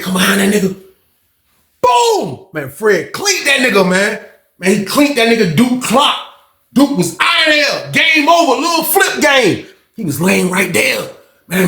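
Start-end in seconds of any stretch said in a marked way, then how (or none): 1.8-2.5 high-pitched falling boom; imitated knockout boom. 6.7-9.7 higher-pitched, drawn-out exclamations; excited recap. Real, not acted. Excited account of the knockout.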